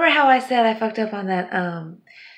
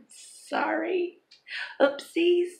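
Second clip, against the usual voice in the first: ordinary delivery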